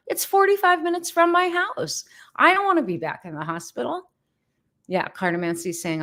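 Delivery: ordinary talk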